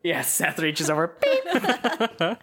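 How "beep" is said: beep sound. Acted. Mute button sound effect.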